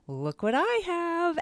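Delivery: high, slow, sing-song "look what I have"; excited reveal. Retold or imitated. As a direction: show off a treasure to little kids. Showing books to first graders.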